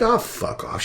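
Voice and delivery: breathy dismissive scoff. Retold or imitated. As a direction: brush it off with a hushed sneer. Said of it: "You know, it's like".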